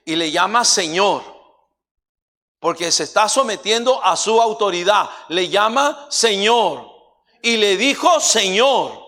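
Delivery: emphatic preacher at high volume; full-force preaching. Real, not acted. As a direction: thunder the title out to the congregation.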